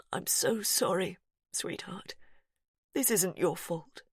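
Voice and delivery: sorry through held-back tears; father soothing his frightened little daughter; tearful apology. Acted. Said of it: "tears filling his eyes".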